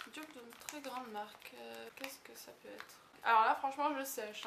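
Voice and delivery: usual speaking voice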